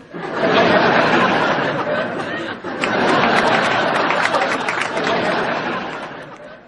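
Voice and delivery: high breathy burst of laughter; big laugh. Real, not acted. Co-host laughing hard at the patient's answer. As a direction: crack up at the punchline.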